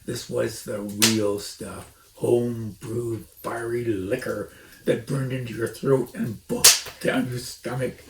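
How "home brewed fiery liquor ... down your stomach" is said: usual speaking voice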